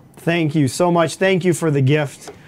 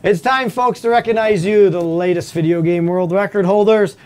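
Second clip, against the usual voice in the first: announcer voice; 5 semitones higher than usual. Acted. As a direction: build up the big segment. Show host announcer build-up.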